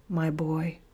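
soft, tender and pained; a father's hushed, aching love. A narrator's reading. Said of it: "in a voice of love and pain".

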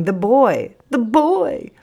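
put-on cried-out 'the boy', pitch leaping up; screamed cry. A narrator's reading. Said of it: "She is screaming".

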